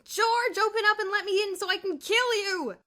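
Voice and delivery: high, loud mock-murderous shout; furious yell. A narrator's reading. Bruno threatens George through the door, shouting.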